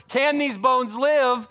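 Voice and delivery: high, loud, very slow question; a loud slow preached question; preaching at full force. Acted. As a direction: let the question ring out.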